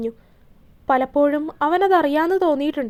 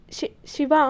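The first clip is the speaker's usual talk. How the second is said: stammering; 5 dB quieter, much slower than usual. A narrator's reading. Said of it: "അയാൾ പതർച്ചയോടെ വിളിച്ചു".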